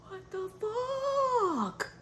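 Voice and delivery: high, drawn-out disbelief; stunned slow what. Retold or imitated. In the waiting room, high slow stunned disbelief. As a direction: stretch it slow in awe. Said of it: "I was like".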